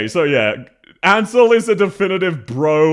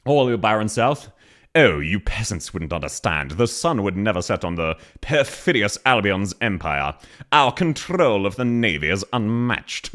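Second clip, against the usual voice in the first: mock posh British; 8 semitones lower than usual. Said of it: "how British she is".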